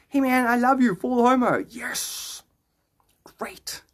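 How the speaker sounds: ordinary delivery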